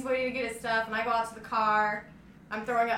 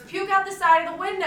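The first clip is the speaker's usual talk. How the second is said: raised, high-pitched voice quoting the driver; exasperated retold order; 9 semitones higher, 7 dB louder, faster than usual. Driver refusing to stop for a sick passenger, exasperated. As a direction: imitate a driver's loud command.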